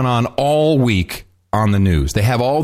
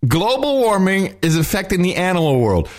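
higher, dramatic mock of the anchor's line; mock reporter; 7 semitones higher than usual. Host mimicking the reporter's clip line, mock-dramatic. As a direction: parrot the reporter with mock alarm. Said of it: "repeats the clip".

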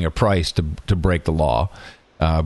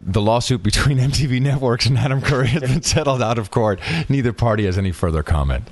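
half-joking official statement voice, a touch higher; mock news read; 4 semitones higher than usual. The host to his co-host, mock-reading a settlement announcement. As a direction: read it like a dry press release, with a stifled laugh.

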